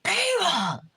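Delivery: very high gasped mock amazement; astonished gushing. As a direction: imitate an awestruck admirer, high and over the top. Smitten man amazed at no extensions.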